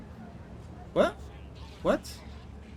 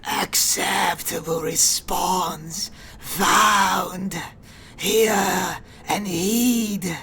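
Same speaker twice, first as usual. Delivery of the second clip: slow, whispery mechanical hiss; 2 semitones higher, 3 dB louder, much slower than usual. Acted. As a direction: hiss each word slowly like a machine priest. Keeps the tech priest voice.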